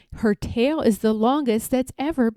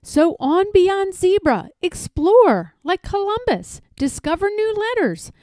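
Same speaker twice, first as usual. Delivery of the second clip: rousing call; 9 semitones higher than usual. A narrator's reading. Narrator rallies Conrad onward, excitedly high. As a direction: voice climbs high, big and rallying.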